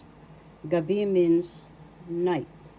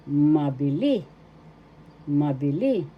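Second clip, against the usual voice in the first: Mabili with the final "li" stressed; stress on third syllable; 3 semitones lower, 3 dB louder than usual. Shown as a demonstration. Teacher repeating the word for learners, accent on final "li" of Mabili. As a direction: say Mabili carefully, landing hard on the last "li". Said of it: "The accent is in the third syllable. That's how you pronounce it".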